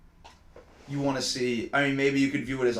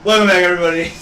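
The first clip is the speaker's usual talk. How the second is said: higher and louder show-host opener; loud host welcome; 6 semitones higher, 14 dB louder, faster than usual. Acted. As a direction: boom it out like a host. Hosting the show.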